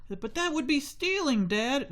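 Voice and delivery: put-on high whiny kid protest; the boy objecting to his dad, pitched high.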